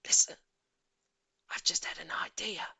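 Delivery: urgent hushed whisper; whispering boy with a plan. A narrator's reading. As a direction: breathe it to the others secretly.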